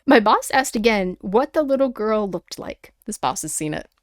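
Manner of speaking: usual speaking voice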